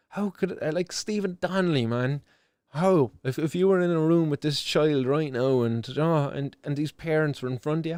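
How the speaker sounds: ordinary delivery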